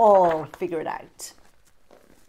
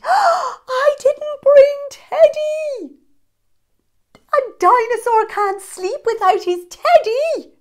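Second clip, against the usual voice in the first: panicked cry; 10 dB louder than usual. Acted. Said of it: "gave a gasp and cried".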